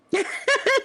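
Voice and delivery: laughing high. Real, not acted. Laugh after bit.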